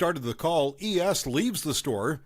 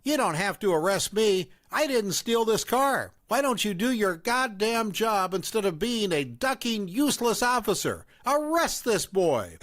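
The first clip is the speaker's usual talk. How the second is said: higher-pitched accusing mother; raised mom voice; 6 semitones higher than usual. A narrator's reading. Mom confronting the narrator at the fast food place, accusing. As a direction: play the suspicious mom, pitched up.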